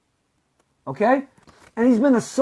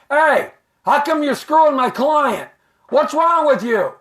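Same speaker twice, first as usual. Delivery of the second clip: raised, loud megaphone-style confrontation; mock angry hollering; 6 semitones higher, 4 dB louder than usual. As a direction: holler it at an office from the street, acting mad. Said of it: "When I get mad at somebody".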